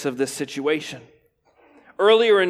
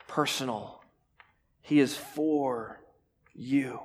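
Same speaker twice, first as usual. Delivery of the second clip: tender; 3 semitones lower, 7 dB quieter, slower than usual. Acted. Intimate slow sermon point.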